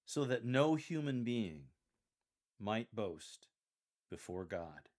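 "no human being" slow and forceful, pauses after "being" and "boast"; strong, with pauses. Shown as a demonstration. Coach models Paul's warning, strong delivery pausing after "being" and "boast". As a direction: stress "no human being", then break before "might boast" and "before God". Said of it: "warranting a strong delivery, with multiple pauses for emphasis".

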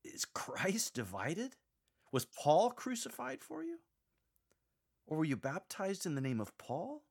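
scornful questions; mocking. Shown as a demonstration. Paul ridiculing the Corinthian factions with mocking questions. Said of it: "resume the mocking tone".

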